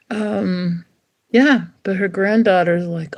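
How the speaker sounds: ordinary delivery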